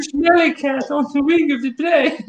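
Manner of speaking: high-pitched mock Russian-English; Russian-English accent. Joker spotting something on the wing, in high Russian-English. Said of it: "I gotta drop the accent".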